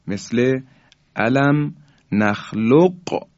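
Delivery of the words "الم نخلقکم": slow, with qaf of نخلقکم sounded distinctly before kaf; unmerged qaf. Shown as a demonstration. Tajwid teacher shows the word before merging, qaf fully sounded. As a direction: say نخلقکم with a separate, bouncing q, then kum. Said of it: "یعنی قاف ساکن هست".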